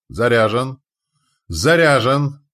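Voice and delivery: loud repeated call; shouting. A narrator's reading. Reporting loaded crossbow in battle.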